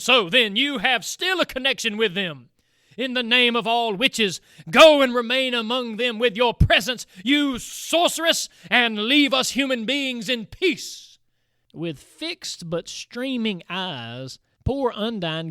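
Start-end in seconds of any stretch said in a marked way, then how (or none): 0.0-11.2 higher, louder furious cry; raging. A narrator's reading. The knight raging at Undine, furious. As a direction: hurl the curse at her. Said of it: "mad with rage exclaimed".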